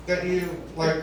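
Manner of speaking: soft, halting, awkward asking; hesitant question. Retold or imitated. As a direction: ask gingerly, slowly feeling your way. Asks friend awkwardly after years.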